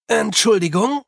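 calling out. A narrator's reading. Calling to a street crowd.

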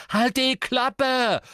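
high, slow, full shout; roaring. A narrator's reading. Brother silences his sister, roaring. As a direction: roar each word out long. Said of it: "brüllte Ron".

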